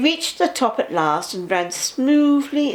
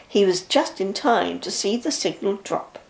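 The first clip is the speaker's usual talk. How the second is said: ordinary delivery, nothing marked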